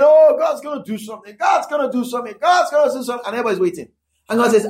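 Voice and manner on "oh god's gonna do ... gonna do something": mock excited. People excitedly expecting God.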